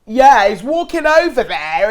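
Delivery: loud, high, drawn-out mock yell; imitated shouting. Imitating Tasha shouting random things when upset, mock shout. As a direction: mimic her yelling across the villa. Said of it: "she'll shout random, like".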